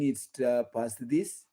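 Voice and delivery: ordinary talk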